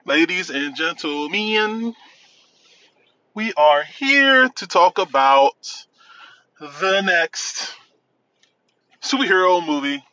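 slow, grand announcer intro. Pretend. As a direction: present it like a ringmaster. Grand opening announcement of review.

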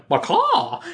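high squawking bird call; chicken squawk. Retold or imitated. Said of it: "And he's like".

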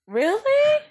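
very high, incredulous "really?"; mock disbelief. Mom reacting to her son's snub, high-pitched mock disbelief. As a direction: squeak it out in mock offense. Mom retells hurt reply to son.

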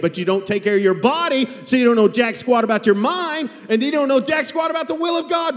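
high, loud pulpit exhortation; full-voiced sermon push; fiery preaching. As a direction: preach it hard at the congregation.

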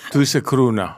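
"crooner" in a put-on English accent, final r dropped to "cruna". Shown as a demonstration. Host coaching a co-host on the word, English accent. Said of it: "si tú lo quieres decir con acento inglés".

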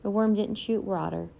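usual speaking voice

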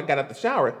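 ordinary delivery

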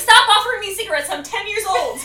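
fast, high, very loud outburst; exasperated mock yell. Boy refusing cigarettes from his friend, exasperated mock yell. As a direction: yell it as a fed-up child, imitated.

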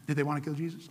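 ordinary talk, nothing marked

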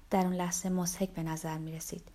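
plain reading voice

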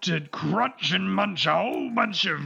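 high, slow, drawn-out ogre. Acted. Ogre weighing up the dwarf's offer, slow creature voice. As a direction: stretch each phrase like a lumbering ogre. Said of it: "And then he goes".

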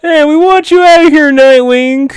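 high, loud shouted mock voice; a squeaky shouting bit-part actor; mock extra yelling. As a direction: ham up a panicky extra's yell. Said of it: "one guy shoots Nightwing".

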